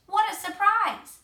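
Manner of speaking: high, loud startled voice; surprised. A narrator's reading. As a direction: blurt it out shocked. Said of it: "she gasped".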